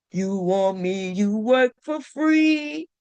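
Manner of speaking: slow sung-like build toward the note; stretched disbelief, slow; slow, drawn-out take. Shown as a demonstration. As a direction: drag every word out slowly, incredulous. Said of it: "Look how I hit that note".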